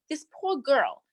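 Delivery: ordinary talk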